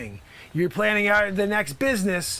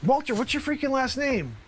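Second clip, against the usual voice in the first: normal talking voice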